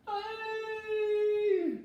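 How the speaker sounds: sudden piercing scream; high terrified shriek. Acted. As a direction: shriek from a nightmare. Billy screams again.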